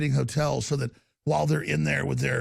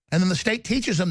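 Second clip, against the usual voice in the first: normal talking voice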